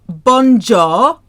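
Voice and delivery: "bonjour" as loud anglicised "bon-jaw"; loud, drawn-out English "bon-jaw" for "bonjour"; mispronounced bonjour. Shown as a demonstration. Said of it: "It's not bonjour".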